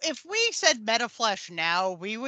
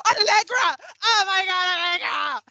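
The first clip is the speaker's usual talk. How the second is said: high, loud fan cries; mock-ecstatic shouting at an idol; gushing fan impression; 8 semitones higher, 7 dB louder than usual. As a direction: squeal it like an overexcited fan. Mimicking a gushing fan's outburst.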